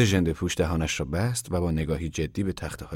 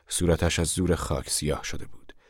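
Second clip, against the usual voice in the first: ordinary delivery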